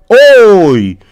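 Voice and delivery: loud, high trance cry; seance medium. Pretend. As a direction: gasp out as the spirit arrives. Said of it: "vamos a entrar en trance".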